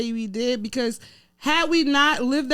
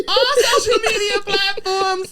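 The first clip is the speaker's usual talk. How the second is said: high, loud laughing cry; 7 semitones higher, 4 dB louder than usual. Real, not acted. Co-host fumbling the social plugs, laughing hard. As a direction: call it out while cracking up. Cracking up while fumbling the sign-off.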